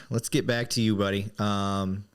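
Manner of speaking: ordinary talk, nothing marked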